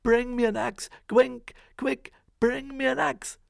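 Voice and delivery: high, loud shout for help; urgent call. Acted. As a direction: Jack calls desperately to his mother. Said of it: "he called out".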